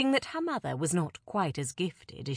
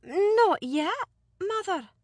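careful daughter; 13 semitones higher, 4 dB louder, slower than usual. Acted. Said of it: "she replied carefully".

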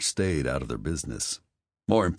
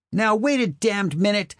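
higher, louder indignant objection; angry protest; 5 dB louder than usual. A narrator's reading. Mother objects to Spencer taking Wesley away, indignant and loud. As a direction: cut him off like an outraged mother. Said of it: "His mother started to argue".

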